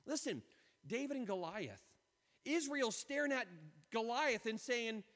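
normal talking voice

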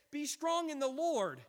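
strong hit hard and high; stress on strong. Pretend. Pastor quoting God's command, strong punched. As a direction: land on strong in Be strong in the Lord. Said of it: "He says".

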